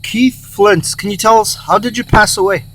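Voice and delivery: ordinary talk, nothing marked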